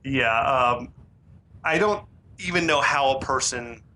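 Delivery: ordinary talk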